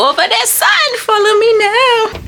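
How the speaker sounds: playful high command. Acted. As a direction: order the little one about playfully. Mock command to toddler.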